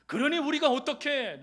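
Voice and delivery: 우리가 pushed up high and loud. Shown as a demonstration. Preacher pressing the congregation, loud and high. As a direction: raise the voice on the repeat. Rhetorical question repeated louder.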